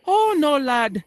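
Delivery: lightly voiced old Irish man, high start; a hint of an old Dublin local, cheerful; old Irishman. Old man telling the American his next trip.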